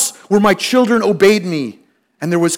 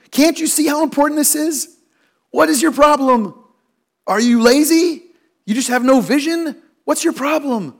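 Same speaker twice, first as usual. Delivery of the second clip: raised, pointed questions at a slacker; mock exasperated demands; 7 semitones higher than usual. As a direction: needle them, half-playful frustration. Frustrated man confronting unhelpful people.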